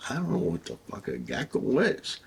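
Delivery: baffled retort. Retold or imitated. Officer answering his partner about geckos, baffled. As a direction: act out being baffled, pitch climbing. Retells own baffled reply.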